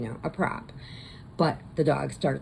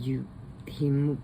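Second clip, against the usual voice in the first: ordinary talk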